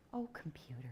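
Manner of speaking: a sigh at the laptop, whispered; exasperated whisper. Real, not acted. Speaker whispering at her misbehaving laptop, exasperated. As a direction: whisper it under your breath, exasperated. Tech fails on stage.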